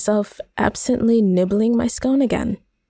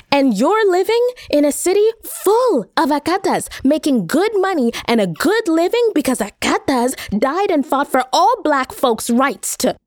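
rising anger; 8 semitones higher than usual. Acted. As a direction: lash out, voice climbing with indignation. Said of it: "My voice and color are rising".

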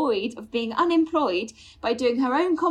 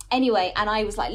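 ordinary talk

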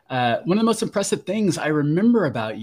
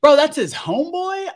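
high, loud incredulous question; can't believe they're friends; disbelief; 13 semitones higher, 5 dB louder than usual. Retold or imitated. Recalling shock at the guest and Jamil, high loud disbelief.

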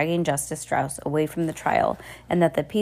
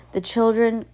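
plain reading voice

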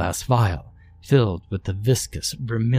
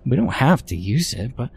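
put-on a fluttery, much higher older woman begging to just try it; Mildred's voice; 7 semitones higher than usual. A narrator's reading. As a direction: voice Mildred pitched up high and coaxing. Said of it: "said Mildred".

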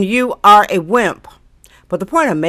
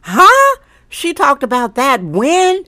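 incredulous what; 6 semitones higher, slower than usual. Retold or imitated. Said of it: "you'll be like".

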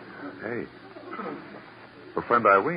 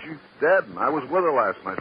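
loud, high rattled protest; he panics at the news; agitated protest; 7 semitones higher, 4 dB louder than usual. Acted. Waiter protesting to the lieutenant, loud rattled disbelief.

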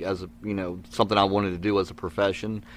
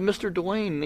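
lightly voiced kid, pitched higher; a small boy calling up to the man, higher; nine-year-old me; 10 semitones higher, 3 dB quieter, slower than usual. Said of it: "I was nine years old".

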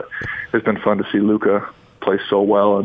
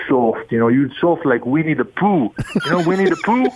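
higher, exaggerated Italian accent; 6 semitones higher than usual. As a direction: mimic an Italian coach scolding. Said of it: "in his Italian accent".

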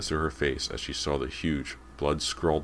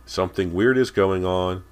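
raised, higher-pitched shout; 4 semitones higher, 7 dB louder than usual. A narrator's reading. Girl hurrying toward the kitchen, shouting. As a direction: shout the news ahead of you. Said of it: "Callie shouted".

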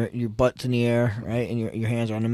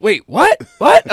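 stunned disbelief; 11 semitones higher, 12 dB louder, slower than usual. Retold or imitated. Ref says Tino won.

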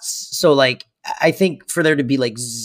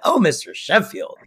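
swooning Fran; 6 semitones higher than usual. Retold or imitated. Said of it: "I went".

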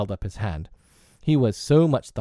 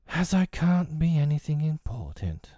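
put-on high, forlorn child's voice; dejected little boy; getting heavier; 7 semitones higher than usual. A narrator's reading. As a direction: sound weighed down, pitched high. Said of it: "getting heavier and heavier and heavier".

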